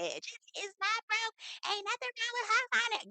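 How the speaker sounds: high, slow mock-indignant fan voice; mock offended fan. An offended commenter scolding the vlogger, high and huffy.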